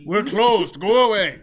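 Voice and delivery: grumpy recluse yelling go away; gruff shout through a door. Acted. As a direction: bark it out, loud and curt.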